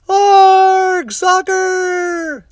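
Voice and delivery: very high, loud chorus yell; crew yelling. A narrator's reading. The crew copying their captain, very high loud yell. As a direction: echo the captain like a rowdy crew. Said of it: "the crew yelled".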